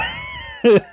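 burst of laughter. Real, not acted. Vlogger laughing at sounding like a big nerd.

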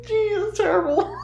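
high giggling words, breaking into laughter; laughing. Real, not acted. Laughing at her own joke about the pilgrims. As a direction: laugh through it, barely getting the words out.

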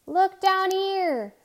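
high, slow, loud shout to the sky. A narrator's reading. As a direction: bellow up at a passing plane. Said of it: "He shouted".

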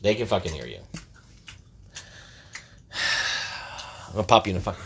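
ordinary talk, nothing marked

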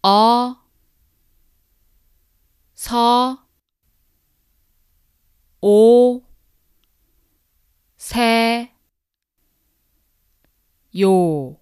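"어, 서, 오, 세, 요" each syllable loud and separate. Shown as a demonstration. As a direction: spell out "어 서 오 세 요" one syllable at a time. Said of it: "Two times".

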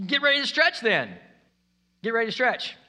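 high, loud comeback; forceful retort. Acted. Preacher answering the would-be bigger-life seeker, forceful retort.

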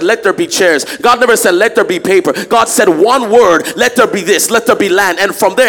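loud, driving sermon; preaching. Acted. Pastor preaching to the church about creation, loud. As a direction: hammer the point home.

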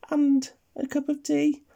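high little granny voice; sweet granny voice. Acted. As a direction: speak like a doting old granny. Granny voice in the story.

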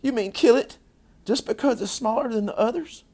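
high, loud appalled protest; horrified, high-pitched questioning; child's yell. A narrator's reading. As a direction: yell it as an upset little girl. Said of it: "Don't yell, Fern".

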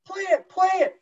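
a teammate shouting an order across the ice; retold shout. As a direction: call out to the goalie to play it. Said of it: "My D-man goes".

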